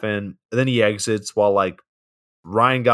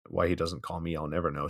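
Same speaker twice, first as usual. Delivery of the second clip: much softer and lower; quiet, low throwaway remark; muttered aside; 5 semitones lower, 13 dB quieter, faster than usual. Real, not acted. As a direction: drop it under your breath. Wistful aside about the actor.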